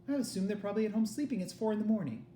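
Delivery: mother's voice. Acted. Mother answers soldier.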